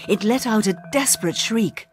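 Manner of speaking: plain reading voice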